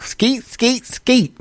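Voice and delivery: high, soft squeaky skeet sounds; a silly high sound effect; mock skeet noise. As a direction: chirp the skeets up high and light. Imitating ejaculation sound.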